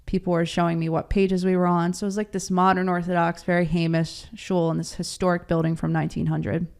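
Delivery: ordinary talk, nothing marked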